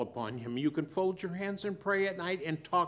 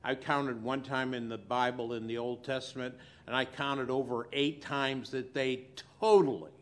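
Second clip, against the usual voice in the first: ordinary talk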